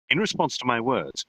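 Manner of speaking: usual speaking voice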